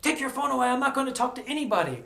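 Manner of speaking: mock policeman snapping a refusal; gruff officer. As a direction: rattle it off like an annoyed cop. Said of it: "He was like".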